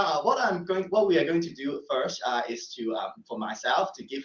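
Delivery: normal talking voice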